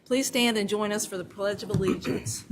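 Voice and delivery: normal talking voice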